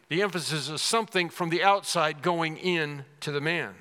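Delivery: normal talking voice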